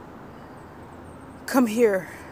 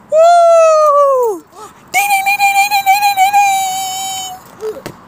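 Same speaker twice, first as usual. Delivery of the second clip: very high, loud whooping bell calls; excited shout; 8 dB louder than usual. Real, not acted. Celebrating the knockout, very high and loud. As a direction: ring the victory bell with your voice.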